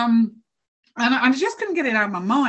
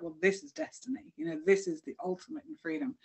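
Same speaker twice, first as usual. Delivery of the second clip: softer, hushed wonder; quiet awe; 2 semitones lower, 10 dB quieter than usual. Retold or imitated. To herself on starting the walk, softly awed. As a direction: say it softly, almost to yourself. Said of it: "So then it was like".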